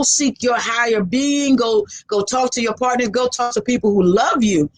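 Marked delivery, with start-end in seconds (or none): none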